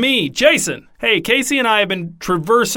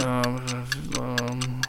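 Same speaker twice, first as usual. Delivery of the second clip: barely audible, mumbling softly; soft talker; 9 semitones lower, 14 dB quieter than usual. Acted. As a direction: speak almost too quiet to hear. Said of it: "Adam is a soft talker".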